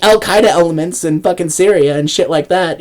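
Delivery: ordinary delivery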